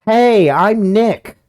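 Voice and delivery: slow, drawn-out loud yell; shouting. Acted. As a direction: belt the name out. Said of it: "I'm not saying it loud enough".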